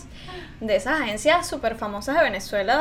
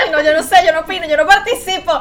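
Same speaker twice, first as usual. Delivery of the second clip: laughing, louder protest; 4 semitones higher, 7 dB louder than usual. Real, not acted. Teased by her friends, she laughs off the topic. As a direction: giggle it out, refusing to get involved.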